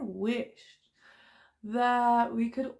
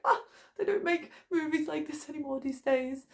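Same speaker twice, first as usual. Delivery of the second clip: retold weepy gushing; 7 semitones higher than usual. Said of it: "I was just crying".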